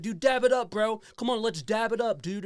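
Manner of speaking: imitating a kid begging for a dab; mock teen. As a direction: ham up a pushy teenager. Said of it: "He's like".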